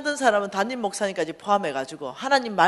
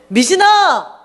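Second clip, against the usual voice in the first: high, loud shout after someone leaving; drawn-out, high-pitched yell of a name; calling out; 11 semitones higher, 15 dB louder, much slower than usual. Retold or imitated. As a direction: call the name out loud to stop a friend. Friend calls after her walking away.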